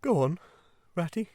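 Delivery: high, subdued toad voice; tearful murmur. A narrator's reading. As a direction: murmur it, crushed. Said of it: "he murmured presently".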